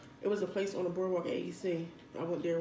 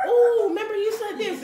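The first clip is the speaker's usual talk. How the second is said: high, loud laughing mimic; imitating Aisha; 14 semitones higher, 11 dB louder than usual. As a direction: squeal it while laughing. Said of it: "She's like".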